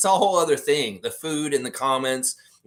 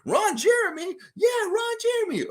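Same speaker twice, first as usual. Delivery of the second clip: much higher falsetto voice, comic; silly falsetto greeting. Retold or imitated. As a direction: squeak it like a cartoon. Mimicking greeting to Jack.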